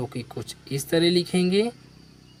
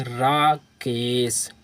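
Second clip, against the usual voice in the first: slow reading; 3 semitones lower, much slower than usual. Shown as a demonstration. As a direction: draw the name out slowly. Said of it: "इसको क्या पढ़ेंगे?".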